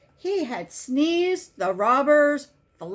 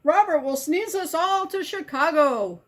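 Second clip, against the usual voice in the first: excited warning; 7 semitones higher than usual. A narrator's reading. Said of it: "yelled the doctor".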